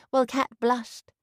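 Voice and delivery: ordinary delivery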